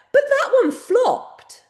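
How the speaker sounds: high, loud, drawn-out complaint; indignant disbelief. Real, not acted. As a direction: protest it slowly, high and half laughing. Mock outrage at failed clip.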